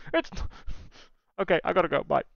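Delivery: ordinary talk, nothing marked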